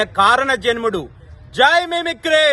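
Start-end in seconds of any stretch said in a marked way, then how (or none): 1.6-2.5 shouted rallying cry, much higher; slogan call. Real, not acted. Disciple leading the tribute chant for his guru, loud high call. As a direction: call it out for the crowd to answer.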